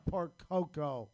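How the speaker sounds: usual speaking voice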